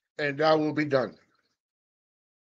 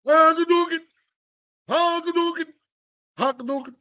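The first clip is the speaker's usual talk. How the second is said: Hadouken shout; 11 semitones higher than usual. Pretend. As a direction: shout it like a game fighter. Street Fighter special move shout.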